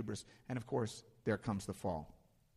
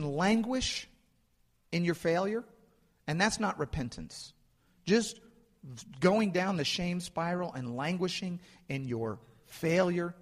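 "going" pushed higher and louder; louder, pointed preaching; 5 semitones higher, 6 dB louder than usual. Acted. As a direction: press the warning, louder. Sermon peak on shame spiral.

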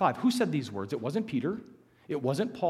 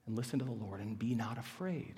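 whispered reassurance; hushed whisper; 5 semitones lower, 12 dB quieter than usual. Real, not acted. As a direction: drop to a whisper on the comfort. Preacher drops to whisper.

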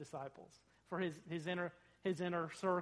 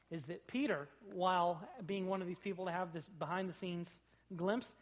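ordinary delivery

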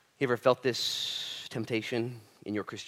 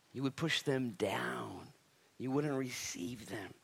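soft, slow, hurt pleading; heartbroken; 7 dB quieter, slower than usual. Acted. Jesus heartbroken at the city that killed prophets, soft and slow. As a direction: speak as if heart is breaking. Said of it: "this is breaking his heart".

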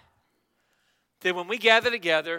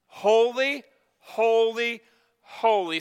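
raised, higher proclamation with drawn-out words; all-caps exclamation; 4 semitones higher, slower than usual. Shown as a demonstration. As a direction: proclaim each word like an exclamation point. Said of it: "saying in all caps with exclamation points".

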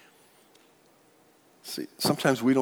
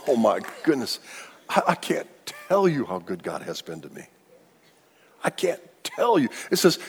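ordinary talk, nothing marked

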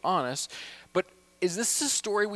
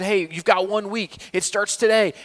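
retold challenge; 4 semitones higher, 8 dB louder than usual. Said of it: "last week I said".

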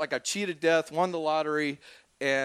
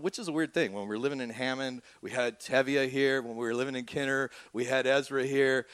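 normal talking voice

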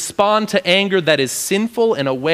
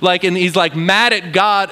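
usual speaking voice

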